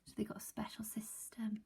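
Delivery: very soft whispered aside. Real, not acted. Teacher explaining the system to class, whispered. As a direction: barely breathe it.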